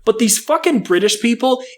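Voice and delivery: ordinary talk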